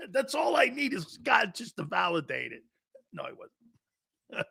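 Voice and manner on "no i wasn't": whisper. Real, not acted. Denies being at the game.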